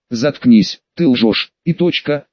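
ordinary delivery, nothing marked

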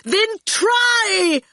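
very high, exasperated yell; angry yell. A narrator's reading. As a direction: yell it as a furious order. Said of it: "Torquil yelled at him".

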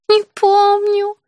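high, drawn-out plaintive voice. A narrator's reading. Patient unable to remember the child's age, plaintive. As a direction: whine it slowly and helplessly. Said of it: "жалобно произнесла собеседница".

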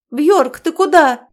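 high loud startled call; surprised cry. Acted. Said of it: "удивлённый возглас вскочившей с места Киры". (удивлённый возглас)

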